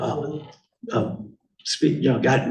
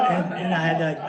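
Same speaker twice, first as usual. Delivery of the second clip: ordinary talk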